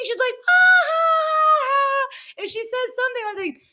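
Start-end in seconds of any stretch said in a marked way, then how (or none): 0.5-2.1 high, loud mock cackle; imitated mother's laugh. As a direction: laugh big and high, mimicking mom. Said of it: "she starts laughing".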